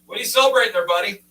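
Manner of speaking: a chipper clerk teasing a customer; cashier. Retold or imitated. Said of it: "They go".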